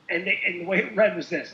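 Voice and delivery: ordinary talk, nothing marked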